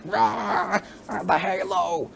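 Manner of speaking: mock overacted prophet cry, pitched high; hammed-up imitation of a preachy actor yelling "Rah, the Halo!"; overacting prophet. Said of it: "this is an example of overacting".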